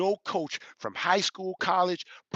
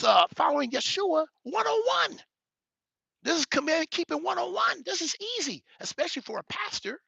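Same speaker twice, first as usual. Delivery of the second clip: high-pitched, fervent preaching build; 9 semitones higher than usual. Acted. Preacher hammering his point to the congregation, fervent. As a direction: preach it up to the rafters.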